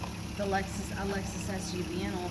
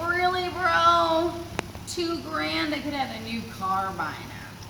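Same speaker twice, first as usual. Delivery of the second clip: incredulous retort; 6 semitones higher, 5 dB louder, slower than usual. Retold or imitated. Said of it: "I'm like".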